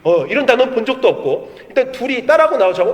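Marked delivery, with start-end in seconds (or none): none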